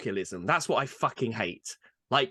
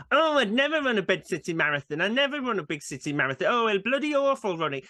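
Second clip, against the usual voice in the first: mock Yorkshire moaner, pitched up; northern English accent; 6 semitones higher than usual. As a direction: whinge in a broad northern accent. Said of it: "They've got a northern accent".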